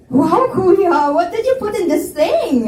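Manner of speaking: loud, high, laughing delight; girl thrilled by the sweet shake, laughing. Acted. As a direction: laugh out the surprise at the taste.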